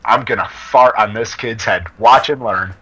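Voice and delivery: low, confiding aside to a friend. Acted. Teller to his friend in the store, low confiding voice. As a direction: drop the pitch and confide the plan. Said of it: "I tell my friend".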